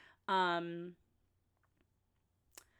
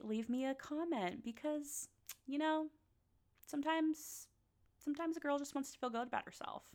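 put-on higher, faster girlish plea; higher sassy girl voice begging for comments; mock needy; 7 semitones higher, 4 dB quieter than usual. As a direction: play the needy diva.

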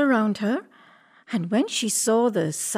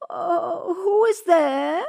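put-on high, drawn-out old granny wail; grandmother crying out; 9 semitones higher, slower than usual. A narrator's reading. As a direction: call out slowly in a high granny voice. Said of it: "cried the grandmother".

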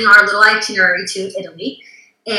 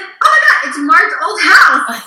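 much higher, louder, slow oh my God; excited discovery; 9 semitones higher, 7 dB louder, slower than usual. Retold or imitated. Guest recalling spotting Marge's old house online, much higher and louder. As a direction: gasp it out as you recognize the house.

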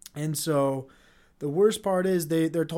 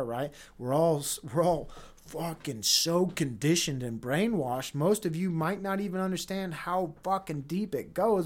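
ordinary talk

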